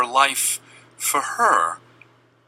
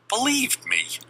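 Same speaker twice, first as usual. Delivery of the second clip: normal talking voice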